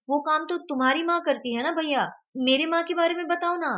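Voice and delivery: put-on high, loud little boy voice; child's voice. A narrator's reading. Vedant pleading with his friend to tell about his mother.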